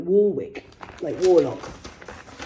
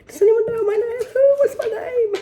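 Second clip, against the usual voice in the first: mock bewildered; 6 dB louder than usual.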